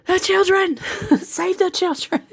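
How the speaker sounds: high, frantic cry of alarm; frantic brain voice. Retold or imitated. Her brain panicking about saving the children, high frantic cry. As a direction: shriek it like a panicked inner voice. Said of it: "My brain was like".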